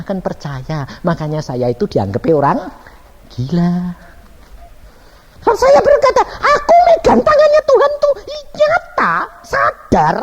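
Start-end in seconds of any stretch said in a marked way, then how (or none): 5.4-10.2 high, loud, impassioned testimony; full-force preaching. Real, not acted. Preacher insists the hand of God was real, loud and high. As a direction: cry it out to the whole congregation.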